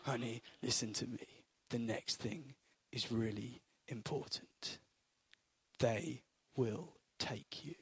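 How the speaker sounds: low, soft whispered calm; Liam Neeson. Retold or imitated. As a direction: hush it like a cool-headed ex-agent dad. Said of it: "I can't do a Liam Neeson impression".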